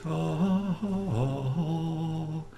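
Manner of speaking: monk murmur. Pretend. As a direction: intone like a chanting monk. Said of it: "My nice impression of a, of a monk there".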